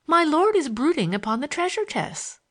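high, mildly theatrical schoolgirl recitation; half quote, half improvise, in a stilted high tone; a touch of mock-romantic grandeur; stilted quoting. A narrator's reading. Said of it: "delivered in the stilted tone".

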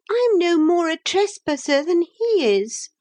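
very high, thin retort; thin, disagreeable voice. A narrator's reading. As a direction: argue back in a high, disagreeable voice. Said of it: "a thin and disagreeable voice".

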